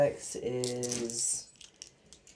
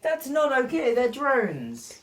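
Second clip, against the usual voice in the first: indignant; 10 semitones higher, 7 dB louder, slower than usual. Real, not acted. Protesting rules.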